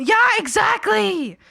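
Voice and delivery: high, loud, slow exclamation; big excited yes; loud emphatic agreement. Real, not acted. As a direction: shout your agreement.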